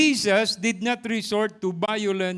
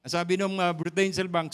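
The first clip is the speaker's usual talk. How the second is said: ordinary talk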